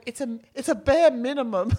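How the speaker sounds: loud, high exasperated cry; exasperated outburst. Retold or imitated. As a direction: throw it out loud in disbelief. Retold exasperated remark.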